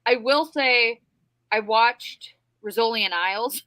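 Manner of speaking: ordinary talk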